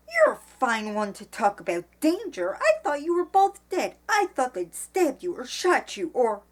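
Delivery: loud, high indignant outburst; furious. A narrator's reading. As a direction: scold with a voice you can't keep down. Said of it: "In her fury, she found it difficult to keep her voice down".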